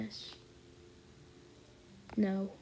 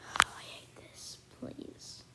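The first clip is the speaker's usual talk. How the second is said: scared whisper; 7 dB quieter, slower than usual. Real, not acted. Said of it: "I'm scared".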